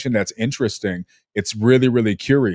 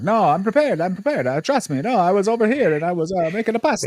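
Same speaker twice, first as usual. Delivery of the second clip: higher-pitched mock Italian accent; Gianmarco's Italian accent; 6 semitones higher than usual. Podcast host imitating his Italian colleague's excuses, mock Italian accent. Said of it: "You sound like Mario when you make an Italian".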